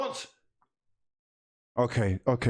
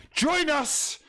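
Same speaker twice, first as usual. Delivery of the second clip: rallying cry; 13 semitones higher than usual. Real, not acted. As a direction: rouse the crowd. Rallying the viewers.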